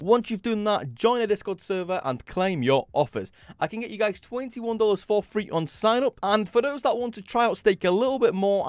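upbeat sponsor ad voice; ad read. Acted. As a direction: pitch the offer brightly. Sponsor ad read mid-video.

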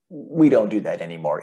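normal talking voice

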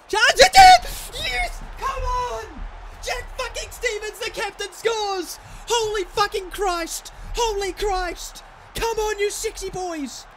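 very high, loud celebratory shouting; ecstatic goal celebration. Real, not acted. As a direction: scream with joy at the goal.

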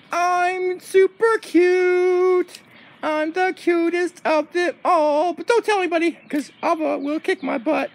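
high, slow, singsong; cutesy dog voice. Pretend. Cute dog confides in viewers, high and drawn out. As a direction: coo it as a vain little dog.

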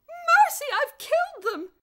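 very high, very loud shriek of horror; horrified cry. A narrator's reading. Miss Scrimmage cries out thinking she killed them. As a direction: shriek before fainting. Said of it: "she cried".

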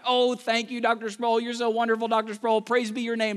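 high fawning gratitude; mock fawning students praising the professor; gushing students. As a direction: gush with thanks like a flatterer.

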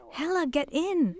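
high urgent call to get on the train; father yelling over the moving train; retold shout. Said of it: "and Daddy screamed".

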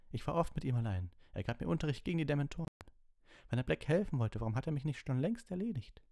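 high, heated argument; indignant, pressing plea; urgent protest. A narrator's reading. Harry argues urgently with Snape.

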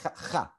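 "cha, cha" with a scraped uvular kh; Israeli elite "cha". Shown as a demonstration. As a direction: rasp "cha" at the back of the mouth, not as Arabic Het. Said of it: "people say".

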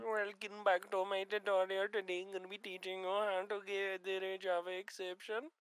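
put-on softened Indian-accented tutorial intro; mock Indian accent. Gamer mimics Minecraft tutorial host, mock Indian accent. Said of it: "Oh, he's fucking Indian".

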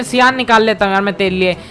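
ordinary talk

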